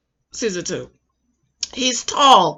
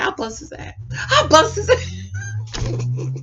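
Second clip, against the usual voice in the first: softer, breaking up over the quoted threat; laughing retelling; 7 dB quieter, slower than usual. Retold or imitated. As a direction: quote the threat while giggling. Quoting a joke she found funny.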